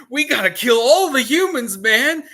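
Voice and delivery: high and loud, a frantic demon's plan; a scared underling's high, loud urging; panicky demon. Retold or imitated. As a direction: bark it like a spooked minion.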